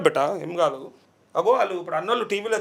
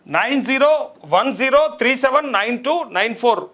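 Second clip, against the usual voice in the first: higher, slow digit-by-digit announcement; slow, raised, measured read-out; 4 semitones higher, slower than usual. Real, not acted. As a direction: spell the number out slowly for viewers. Announces number to viewers for donations.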